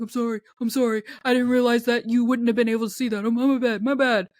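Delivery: fast sheepish apology; rushing to apologize; hurried apology. Retold or imitated. Friend apologizing.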